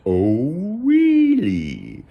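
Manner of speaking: high, loud, long froggy squeal; hammed-up froggy wonder; amazed frog. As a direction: swoop up high in cartoon amazement. Frog reacts to goat.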